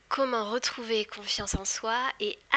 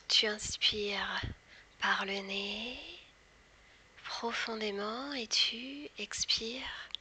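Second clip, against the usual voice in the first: slow whispered guided hypnosis. Acted. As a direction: whisper slowly, guiding into trance. Leads a hypnosis induction.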